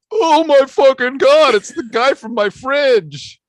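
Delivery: high, loud excited outburst; excited shriek. Retold or imitated. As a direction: squeal it like a starstruck fan. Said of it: "'Cause I was like".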